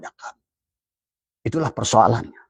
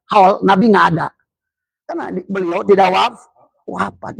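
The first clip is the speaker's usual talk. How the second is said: fervent preaching; 6 semitones higher than usual. Acted. Preacher's rising rhetorical point.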